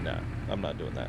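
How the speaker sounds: low, quiet mimicked refusal; imitated refusal. Streamer imitates wife turning down gaming, low quiet refusal. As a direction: lightly mimic wife's deadpan no.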